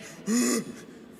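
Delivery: a sharp startled gasp; shocked gasp. Retold or imitated. Husband mock-gasping at his wife's labour roar, startled.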